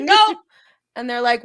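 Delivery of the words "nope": high, loud curt nope; mock king's refusal; flat refusal. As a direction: slam the door on them. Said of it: "he's like".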